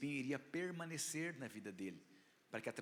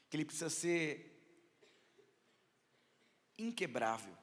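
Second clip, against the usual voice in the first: fiery preaching; 4 semitones higher, 4 dB louder than usual. Acted. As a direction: preach it out with full force. Preaching builds to full force. (pregação inflamada)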